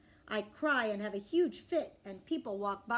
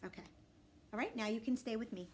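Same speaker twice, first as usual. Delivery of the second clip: plain reading voice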